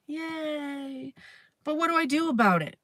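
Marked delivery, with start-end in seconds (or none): 0.1-1.1 flat, sighing sarcastic yay; a deflated sigh of fake cheer. Real, not acted. Sarcastic after bad news.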